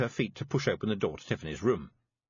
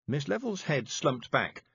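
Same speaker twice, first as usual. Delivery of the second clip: plain reading voice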